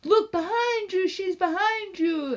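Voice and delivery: high, loud shout of alarm; panicked warning. Retold or imitated. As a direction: yell at someone to look behind them. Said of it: "you'll be like".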